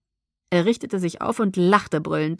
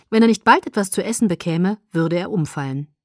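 usual speaking voice

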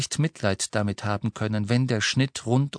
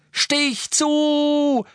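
much higher, loud desperate shout; 5 dB louder than usual. A narrator's reading. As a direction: yell at him to strike. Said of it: "schrie Harry". (Harry schreit Ron an zuzustechen)

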